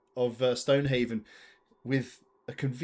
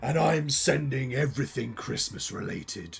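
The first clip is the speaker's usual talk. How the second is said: menacing curmudgeon; 2 semitones lower than usual. Acted. Curmudgeon announces Christmas is being sent, ominous villain voice. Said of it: "I am the Christmas curmudgeon".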